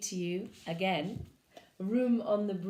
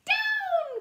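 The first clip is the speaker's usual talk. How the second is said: high shouted command; witch's cry; 8 dB louder than usual. Acted. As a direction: call the broom down with a sharp cry. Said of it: "cried the witch".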